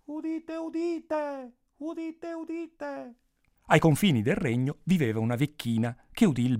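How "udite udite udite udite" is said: high call fading into the distance; same herald's cry, much softer, receding; faraway echoing proclamation; distant town crier. A narrator's reading. Crier's call moving away.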